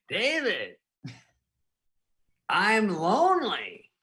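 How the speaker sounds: mock lonely Norm. Norm texting David, hammed-up lonely plea. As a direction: drag it out like a sad friend. Norm voiced in retold text.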